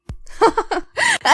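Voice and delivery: a quick, high, breathy-free giggle. Acted. Friend giggling at the gossip, high giggle. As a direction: laugh up high in surprise.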